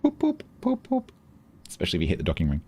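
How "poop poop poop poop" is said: high beeping jingle; high sung beeps. Pretend. Echoing the jingle back.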